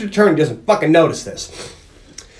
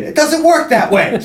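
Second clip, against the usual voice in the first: exasperated retort; 10 semitones higher than usual. Retold or imitated. Acting Barney's exasperated retort.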